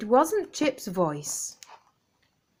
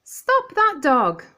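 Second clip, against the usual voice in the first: much higher, louder shouted command; 14 semitones higher, 7 dB louder, slower than usual. Acted. A voice on the television yells after the thieving dog, loud shout. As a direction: yell after a thief to stop him. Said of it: "A voice shouted".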